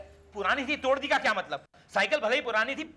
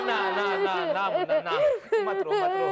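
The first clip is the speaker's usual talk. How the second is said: high, hurried pleading; high-pitched fretful hushing; frantic soothing; 9 semitones higher than usual. Acted. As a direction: plead with a wailing child to stop. Frantically hushing a crying child.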